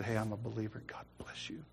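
whispered retold reassurance; hushed confiding. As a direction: drop to a soft whisper, confiding. Retold private word to street preacher.

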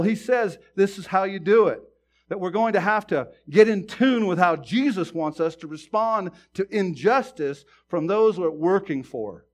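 impassioned preacher building in volume; forceful preaching. Real, not acted. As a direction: drive it home to the congregation.

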